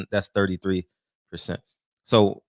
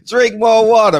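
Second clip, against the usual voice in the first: loud repeat; 8 semitones higher, 7 dB louder than usual. Acted. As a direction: punch it out loud and firm. Repeats daughter's advice emphatically.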